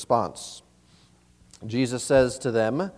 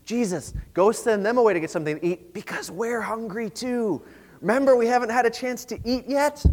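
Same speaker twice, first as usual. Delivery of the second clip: high, put-on hungry pleading; higher, mock-earnest nudge; self-serving pleading; 6 semitones higher than usual. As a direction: plead your own case behind a polite excuse. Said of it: "using the crowd as an excuse".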